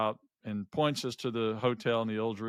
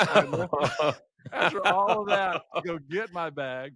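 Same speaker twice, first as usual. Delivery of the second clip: laughing; 6 semitones higher, 4 dB louder than usual. Real, not acted. Laughing at own blunder.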